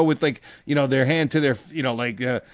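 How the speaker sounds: normal talking voice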